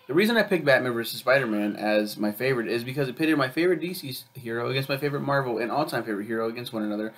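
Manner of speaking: ordinary delivery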